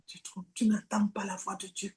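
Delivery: hushed secret whisper. Acted. Preacher whispering the lost believer's doubt, hushed. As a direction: breathe it like a secret.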